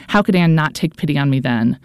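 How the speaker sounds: ordinary delivery, nothing marked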